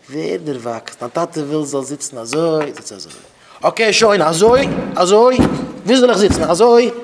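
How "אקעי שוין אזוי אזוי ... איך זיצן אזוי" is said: loud, high exasperated retort; indignant child shouting at father; acted shouting back. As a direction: snap back at a parent, voice raised. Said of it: "וואס שרייסט אויף דיין טאטע".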